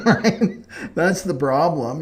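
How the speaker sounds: amused, laugh-tinged. Real, not acted. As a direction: still laughing as you talk. Laughing at own punchline.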